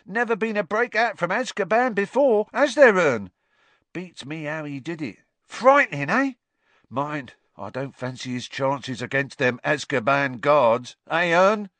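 a high, loud, chatty voice; cheeky Cockney conductor. A narrator's reading. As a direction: gossip like a bus conductor. Stan chats on.

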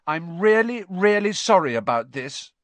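high, emphatic apology; apologetic. A narrator's reading. Hermione before hexing Neville, pained apology.

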